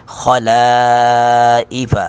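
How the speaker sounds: خَلَائِفَ with لا kept light; thin lam. Shown as a demonstration. Teacher repeats word to show thin lam. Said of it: "لا کو اپنی حالت پہ پڑھیں گے".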